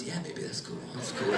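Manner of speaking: hushed, spaced-out stoned dad. Retold or imitated. Comic telling his stoned father's lazy reply. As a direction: drift through it, dazed and mellow. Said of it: "And he goes".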